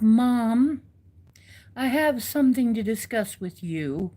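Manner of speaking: a hint of a polite cat asking Mom; voicing the cat.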